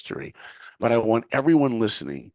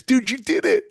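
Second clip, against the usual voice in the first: high, loud, thrilled announcement; excited news; 14 semitones higher, 6 dB louder than usual. Retold or imitated. Friend on the phone telling him he got in, thrilled. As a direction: shout it like the best news ever.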